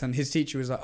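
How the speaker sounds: ordinary talk, nothing marked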